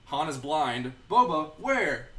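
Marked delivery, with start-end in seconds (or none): 1.1-2.1 high, loud mock cry from a movie hero; mock Han. Host mocking blind Han's slapstick moment, high loud mock call.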